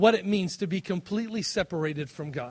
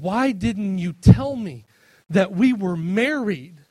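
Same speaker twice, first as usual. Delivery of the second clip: tearful plea; 3 semitones higher, 9 dB louder, slower than usual. Retold or imitated. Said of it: "she's in tears".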